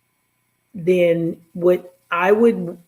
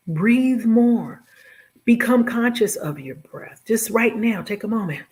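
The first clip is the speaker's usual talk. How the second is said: guided breathing coach, higher and lifted; soothing massage murmur; 3 semitones higher than usual. Acted. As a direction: coax them gently into breathing. Leads a breathing exercise.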